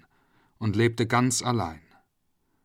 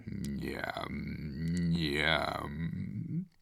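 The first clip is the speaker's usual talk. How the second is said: low, drawn-out hungry njam njam; crocodile munching sounds; 6 semitones lower, 9 dB quieter than usual. Acted. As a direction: smack your lips like a hungry crocodile. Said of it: "machte es immer wieder".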